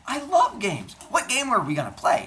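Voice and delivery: high, bright-eyed eagerness, pitched well up; eager. Acted. Metis thrilled when Zeus suggests a game, high eager voice. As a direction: jump at the idea of a game. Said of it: "She goes".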